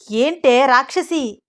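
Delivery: stretched, indignant yelp of words; pained protest. Acted. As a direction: cry out in mock outrage at being scratched. Ram scratched by Sita, protests.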